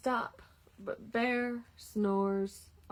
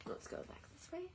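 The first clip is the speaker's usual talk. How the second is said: whisper; 7 semitones higher, 15 dB quieter than usual. Real, not acted.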